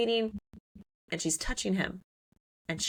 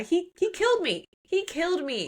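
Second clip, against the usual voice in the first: high-pitched retold outrage; acting out an aggrieved complaint; mock indignation; 10 semitones higher than usual. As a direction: protest it high, half-laughing. Ivy indignant about her father.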